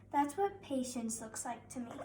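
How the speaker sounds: ordinary delivery, nothing marked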